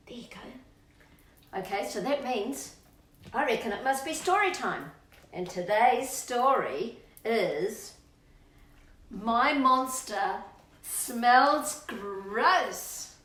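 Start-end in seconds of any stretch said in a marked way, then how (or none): 0.1-1.6 slow soft whisper; slow hushed whisper. Real, not acted. Dog settles down. 9.1-13.2 slow, louder title read; slow dramatic title; story title announcement. Acted.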